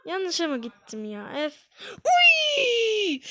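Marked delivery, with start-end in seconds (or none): 2.1-3.2 sharp high startled yelp. Real, not acted. Gamer yelping at a sudden game moment. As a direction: yelp as if caught off guard.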